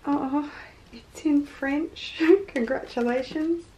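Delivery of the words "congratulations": ordinary delivery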